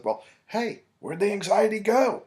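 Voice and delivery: mock puzzled check-in; playful question. Therapist teasing his client about the vanished anxiety. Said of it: "I said".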